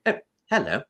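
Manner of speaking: a light C-3PO hello. Retold or imitated. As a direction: greet the old couple like the golden droid. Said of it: "I said".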